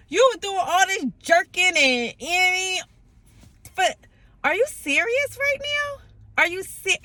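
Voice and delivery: high squeaky outrage at the car; exasperated disbelief. Retold or imitated. Scolding her jerking car in high disbelief. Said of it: "so I'm like".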